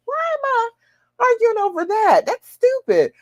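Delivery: very high, incredulous self-talk; high-pitched comic self-scolding; mock self-questioning. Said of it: "sometimes I'll be ready to argue".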